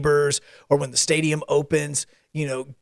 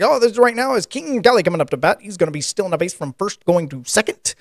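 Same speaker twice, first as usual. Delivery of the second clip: radio drama; 4 semitones higher than usual. Acted. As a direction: call it like a vintage radio broadcast. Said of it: "old-time radio voice".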